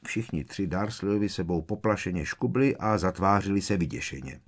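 plain reading voice

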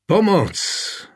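high, desperate shout of Pomoc; desperate cry for help. A narrator's reading. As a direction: call out for help in despair. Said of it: "vykřikl zoufale". (zoufalé volání o pomoc)

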